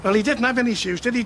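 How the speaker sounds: usual speaking voice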